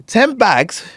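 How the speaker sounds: high, loud disbelief; outraged boss. Acted. Boss hears slang in a corporate meeting, outraged. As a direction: blurt it out, scandalised by the slang.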